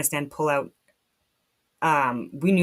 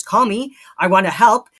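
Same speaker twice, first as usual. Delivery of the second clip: higher, louder plea to be called; calling out; 4 semitones higher, 9 dB louder than usual. Retold or imitated. Appealing to the government, loud plea. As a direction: call out an offer of help. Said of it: "I've been saying for years".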